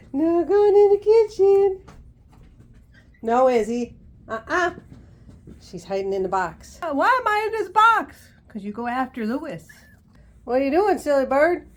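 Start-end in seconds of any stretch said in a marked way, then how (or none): 0.1-1.8 a higher, slower singsong warning to the bird; high, slow sing-song scold. Shown as a demonstration. Owner to parrot heading for kitchen, high slow singsong scolding. 6.8-8.3 voicing the bird.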